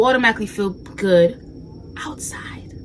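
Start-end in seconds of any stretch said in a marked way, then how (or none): 2.0-2.7 voiceless whisper; hushed, breath-only delivery. Real, not acted. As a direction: drop to a whisper on the word. Hushed word ending her point.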